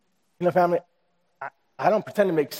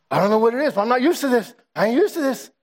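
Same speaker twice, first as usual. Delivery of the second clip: higher, louder, pressing confession; overwhelmed and urgent; 7 semitones higher, 4 dB louder than usual. Retold or imitated. Craig telling Jim he isn't used to this, urgent. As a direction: spill it out like you can't handle it.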